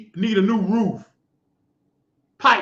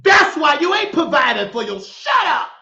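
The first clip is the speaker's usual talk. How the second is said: rising angry yell, pitched high at the end; angry shout; 6 semitones higher, 4 dB louder than usual. Real, not acted. Preacher yells at a woman who brags, angry shout. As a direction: blow up at her mid-sentence.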